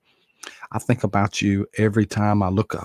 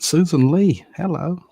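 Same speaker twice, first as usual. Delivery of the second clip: excited greeting; 9 semitones higher, 4 dB louder than usual. Real, not acted. Greeting viewer by name.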